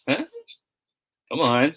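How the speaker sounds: ordinary talk, nothing marked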